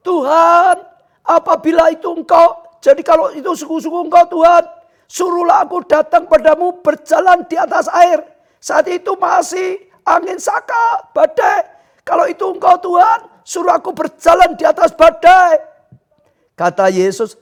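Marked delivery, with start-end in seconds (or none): 0.1-8.2 loud, high-pitched call to the Lord; crying out. Acted. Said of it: "Petrus berseru". 8.6-15.6 loud, high-pitched sermon delivery; full-force preaching. Real, not acted.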